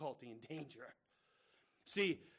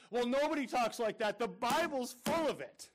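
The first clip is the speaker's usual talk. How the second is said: mock critic; 6 semitones higher, 5 dB louder than usual. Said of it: "I kinda got criticized by someone".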